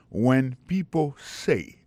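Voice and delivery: very slow, word by word; slow model phrase. Shown as a demonstration. Teacher repeating a phrase to memorise, very slow. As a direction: draw each English word out for learners.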